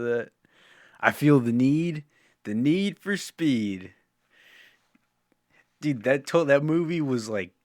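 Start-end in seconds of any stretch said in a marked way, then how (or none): 1.0-3.9 Top Gun catchphrase. Retold or imitated. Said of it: "But how about".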